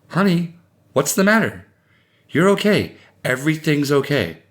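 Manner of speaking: urgent comforting; reassuring husband. A narrator's reading. Reassuring screaming wife and child.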